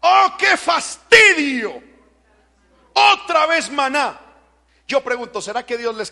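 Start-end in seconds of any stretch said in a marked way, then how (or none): 0.0-4.2 mocking weary groan, loud and drawn out; fastidio actuado. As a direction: act out the bored complaint of the people, slow and loud. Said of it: "¿sabe qué llegaron a decir?". (queja fastidiada y lenta, en voz alta)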